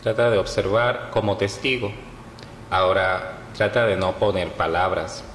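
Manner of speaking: measured meditation voice with long pauses; guided meditation. Acted. Yoga teacher leading listeners through Yoga Nidra, guided meditation. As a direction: guide them phrase by phrase, leaving long silences between.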